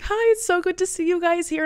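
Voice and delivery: high, gushing imitated welcome; sweet greeting. Acts out big tattooed guy greeting.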